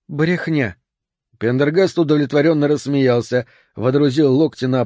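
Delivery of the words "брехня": short, higher dismissive word; коротко бросить. A narrator's reading. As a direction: toss it out in disbelief. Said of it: "коротко бросила". (бросить коротко, с недоверием)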